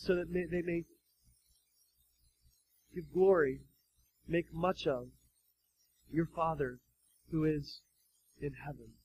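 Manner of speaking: quiet, fading intimate delivery; soft preaching. Acted. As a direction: drop your voice low. Dropping to near hush.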